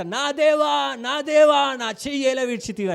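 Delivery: high anguished cry of nా దేవా. Retold or imitated. Jesus cries out loudly on the cross, anguished. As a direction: call out to God in anguish, voice straining high and loud. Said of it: "అని గట్టిగా అరిచినట్ట".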